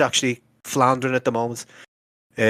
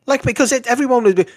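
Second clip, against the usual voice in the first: exasperated rant; 10 semitones higher than usual. Real, not acted. Exasperated rant about fan complaints.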